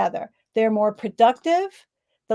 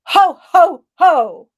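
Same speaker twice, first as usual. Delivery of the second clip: belly laugh from the diaphragm; 6 semitones higher, 10 dB louder, much slower than usual. Shown as a demonstration. Laughter coach demonstrating a loud ho ho ho to the group. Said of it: "we're gonna go".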